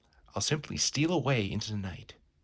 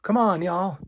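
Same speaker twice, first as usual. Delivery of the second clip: high, loud call to a crowd; 9 semitones higher, 7 dB louder than usual. Acted. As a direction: holler to hurry folks along. Barker calling the crowd in.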